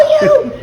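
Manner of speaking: loud high laugh; loud delighted laugh; burst of laughter. Real, not acted. Teller laughing after retold yell.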